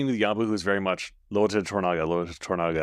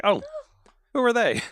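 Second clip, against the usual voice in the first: high-pitched puzzled reaction; mock surprise; 3 dB louder than usual. Priest spots his new neighbors, high mock surprise. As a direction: act out startled curiosity, pitched right up.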